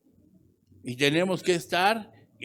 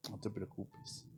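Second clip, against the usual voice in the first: very soft, low comforting voice; acted reassurance; 3 semitones lower, 17 dB quieter than usual. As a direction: soothe the sufferer, almost under your breath. The Lord reassures the sufferer. (consuelo suave, en voz muy baja)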